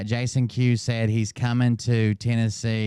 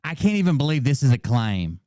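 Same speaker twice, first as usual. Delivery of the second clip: normal talking voice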